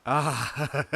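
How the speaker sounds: high, gloating cackle; loud "ah" into a crazed laugh; mad laugh. A narrator's reading. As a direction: cry out and break into laughter. Hildred laughs at Lewis madly.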